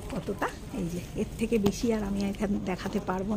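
hushed, soft voice near the nest; speaking softly. Real, not acted. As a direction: speak softly so the birds stay calm. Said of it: "আমি খুব আস্তে কথা বলছি".

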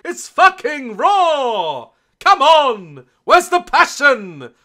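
loud, slow mock chef rant; Gordon Ramsay impression. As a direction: bark like an angry TV chef. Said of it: "She's all Gordon Ramsay".

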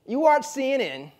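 high-pitched imitation Midwestern accent. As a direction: size him up as a Midwestern bartender, in accent. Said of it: "try to do a Midwestern accent".

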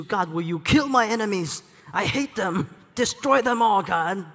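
loud, high, furious plea to God; hammed-up vengeful psalmist; vengeful cry. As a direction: call down wrath on enemies. Acted angry psalm voice.

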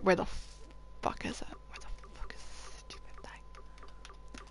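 breathy whispered curse under the breath; frustrated whisper. Real, not acted. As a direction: hiss it to yourself in frustration. Frustrated gamer searching, muttering.

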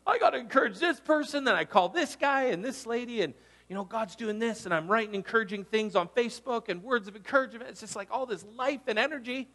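lively high-energy rush; energized. Retold or imitated. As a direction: bubble over with eager plans. Energized after worship.

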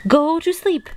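high, exclaimed storybook shout. A narrator's reading. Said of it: "shouted Toad".

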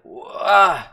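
loud, high burst of amazement; amazed wow. Retold or imitated. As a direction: blurt it out in sheer awe. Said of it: "I was like".

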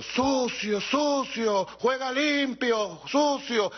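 high mock crowd yell, jeering; mocking shout of a jeering crowd. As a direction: heckle like a stadium mob shouting foul. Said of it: "le gritan".

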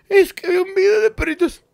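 very high whiny sobbing voice; mock sobbing. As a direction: blubber the excuse. Tearful answer to friend. (explicándole al amigo, llorando exagerado)

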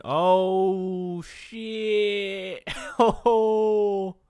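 high, loud, long-held exclamation; shocked shout. Real, not acted. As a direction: holler it out in shock, stretching each word. Shocked reaction to the action.

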